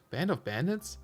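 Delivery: Band of bandits? asked with a rising questioning tune; doubtful rising tune. Shown as a demonstration. Streamer doubting a diary phrase, rising question. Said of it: "that doesn't sound right".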